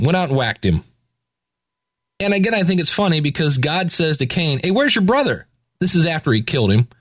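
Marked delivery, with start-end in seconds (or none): 4.6-5.5 high, mock-innocent question. God asking Cain with a high, mock-innocent lilt. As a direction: play dumb about the missing brother.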